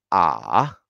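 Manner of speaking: "A" falling low, then swinging up; low dipping third tone. Shown as a demonstration. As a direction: dip deep on "A" before the rise. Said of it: "Pues otra vez".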